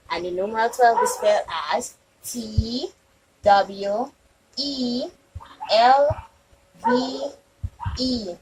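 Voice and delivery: letters spelled one by one with pauses; spelling out letters. Shown as a demonstration. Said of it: "We're going to do it one more time".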